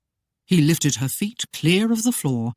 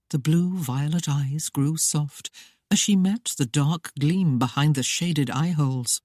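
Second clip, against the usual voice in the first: usual speaking voice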